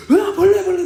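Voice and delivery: high, loud squeal of bug terror; comic frightened yelling; mock panicked shriek. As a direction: imitate a kid freaking out at an insect. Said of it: "막 그러면서 벌레를 끔찍하게 싫어한단".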